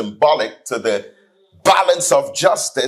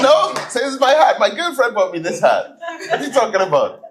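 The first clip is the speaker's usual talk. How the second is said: cheeky denial; 11 semitones higher, faster than usual. Retold or imitated. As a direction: deny it all with a grin in the voice. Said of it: "I say".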